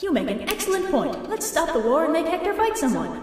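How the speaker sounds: high, prim goddess voice; high, prim mock Athena. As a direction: agree in a high, prissy tone. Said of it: "And Athena's like".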